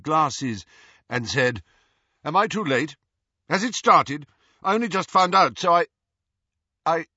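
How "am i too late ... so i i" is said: raised, urgent questions breaking off; flustered, high and loud, petering out; spluttering into silence. A narrator's reading. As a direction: blurt it out, then falter on "I". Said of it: "Percy spluttered into silence".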